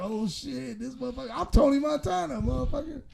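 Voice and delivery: lightly voiced kid playing Tony Montana; a hint of a kid quoting Scarface; kid imitating Tony. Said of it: "We were like".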